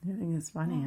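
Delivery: plain reading voice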